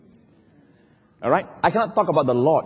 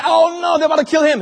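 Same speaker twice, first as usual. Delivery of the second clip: high-pitched dramatic dismay; preacher's hammed-up panic; acted alarm; 10 semitones higher, 3 dB louder than usual. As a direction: cry out as danger closes in. Acts out alarm as king is surrounded.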